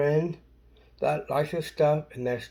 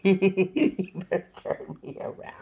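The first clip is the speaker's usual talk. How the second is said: laughing through the words; giggling while speaking; 4 semitones higher than usual. Real, not acted.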